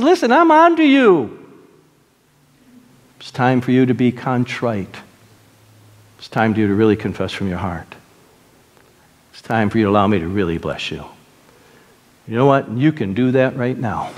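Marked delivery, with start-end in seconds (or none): 0.0-1.4 high, emphatic preacher's confrontation; stern warning. Retold or imitated. God confronts those gaming him. 3.2-11.0 low, quieted invitation; gentle appeal. Retold or imitated. God gently calls to repentance.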